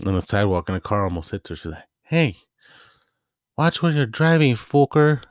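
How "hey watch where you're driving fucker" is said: put-on much higher and louder, cheeky little girl shouting; a tiny girl cussing out a driver; angry kid yell. Girl nearly hit by a car, yelling at the driver high and loud.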